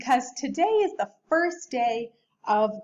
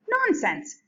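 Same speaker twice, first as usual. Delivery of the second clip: much higher, brushing it off; brisk dismissal; 11 semitones higher than usual. Acted. Father brushing off his daughter, high and brisk. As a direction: wave the worry away. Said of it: "said Mister Hartwell".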